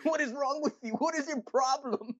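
high, squeaky imitation of Ricky; high squeaky mock incredulity; mock incredulous yell. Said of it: "He's like".

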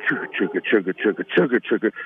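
low mock train chug; train chugging. Imitating the Trump train.

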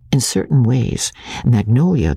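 ordinary delivery, nothing marked

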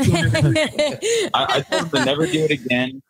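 embarrassed laugh running through the words. Real, not acted. Nephew recalling the spooky Xbox.

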